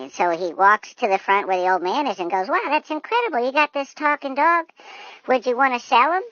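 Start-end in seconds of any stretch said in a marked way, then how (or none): 2.5-6.2 high, excited eagerness; marvel, then eagerly ask; acted excitement, pitched way up; amazed. Amazed man offers to buy.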